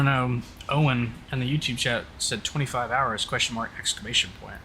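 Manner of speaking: ordinary delivery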